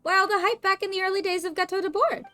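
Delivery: high, questioning character voice; puzzled game character. Acted. Game character asking about the old hype, high and puzzled. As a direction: pitch it well up, puzzled.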